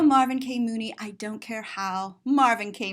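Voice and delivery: ordinary delivery, nothing marked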